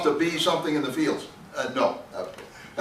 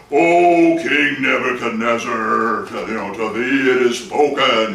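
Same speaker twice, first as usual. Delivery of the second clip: lower, slow, booming divine voice; thunderous voice from heaven; 7 semitones lower than usual. Acted. Heaven's voice calling down to the proud king, low and slow. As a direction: let the voice of God roll down slowly on the king. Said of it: "I should have used my thunderous voice".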